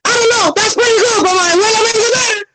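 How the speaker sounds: mock boast about grandma's cooking, high and cartoonish; Juan Pool voice. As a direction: squeak it out as the comic hero. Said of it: "hear in Juan Pool's voice".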